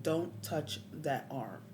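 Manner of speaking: slow, deliberate warning; firm warning. Retold or imitated. Injured man warns ambulance crew off his arm, slow and firm. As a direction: spell out the warning word by word.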